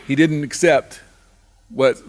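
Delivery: usual speaking voice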